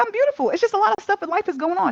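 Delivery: high, fast, defensive protest. Retold or imitated. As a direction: brush it off quickly in a high voice. Retold defensive reply to therapist.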